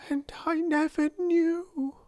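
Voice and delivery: high-pitched, woeful lament. A narrator's reading. Ermengarde dismayed she never knew, woeful. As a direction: cry it woefully. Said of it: "she cried woefully".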